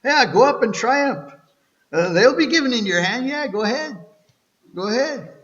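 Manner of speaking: sarcastic prophet telling the king to go ahead. Retold or imitated. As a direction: sneer it out, mock encouragement to the king. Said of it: "I don't know if it's sarcasm or what in his voice".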